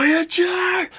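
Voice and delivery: high, urgent shout of a name; desperate yell. Retold or imitated. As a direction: call out to him in alarm. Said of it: "he jumps out of his mech and he's like".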